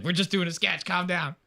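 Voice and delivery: high, laughing plea; mock panicked pleading; pleading Joey. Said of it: "Joey's like".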